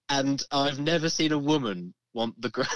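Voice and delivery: ordinary talk, nothing marked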